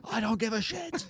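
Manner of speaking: high-pitched comic retort; hammed-up high, dismissive snap; mock irritated stranger. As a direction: squawk it through laughter. Acting the stranger in the cinema.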